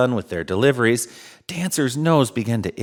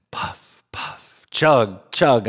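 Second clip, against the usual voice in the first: whispered puffs, then voiced chugs; train sounds; much slower than usual. A narrator's reading. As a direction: puff like a little steam engine. Train sound effect narrated.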